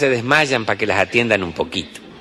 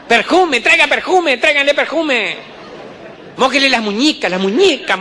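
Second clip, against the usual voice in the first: bossy bystanders; 12 semitones higher than usual. Acted. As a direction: squawk remedies over each other like busybody aunts. Bystanders shouting remedies.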